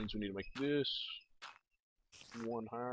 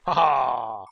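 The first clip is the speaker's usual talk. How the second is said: loud, exultant "ha ha"; triumphant laugh; 2 semitones higher, 12 dB louder than usual. Real, not acted. Player gloating as his trap works, triumphant laugh. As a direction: crow with a big drawn-out ha-haa.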